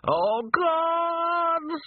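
very high, long-held wail; panicked wail. A narrator's reading. Sergeant about to fall from the roof, wailing in panic. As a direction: wail it high and hold the last word. Terrified, losing hold on roof.

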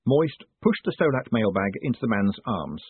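ordinary delivery

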